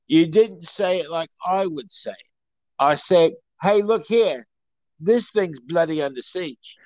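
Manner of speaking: mock-tough Cockney squabble; hammed-up London Cockney one-upmanship; Cockney accent. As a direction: play a Cockney hard man correcting someone. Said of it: "pretty much how Cockneys talk".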